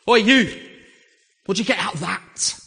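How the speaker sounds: high, loud "Oi, you!" called across the train; mock drunk shout. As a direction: bark it at the stranger like a tipsy commuter. Drunk man calls out on train.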